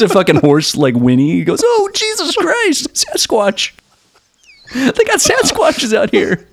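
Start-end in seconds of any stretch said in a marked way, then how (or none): 1.6-6.5 very high, slow comic wail; mock terror. Spooked kid hearing a horse, mock terrified. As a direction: draw out a hammed-up panicked squeal.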